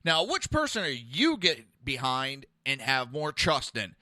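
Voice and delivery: normal talking voice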